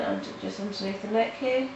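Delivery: ordinary talk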